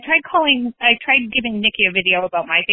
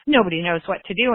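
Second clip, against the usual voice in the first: ordinary talk, nothing marked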